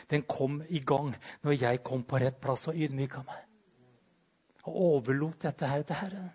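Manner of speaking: ordinary talk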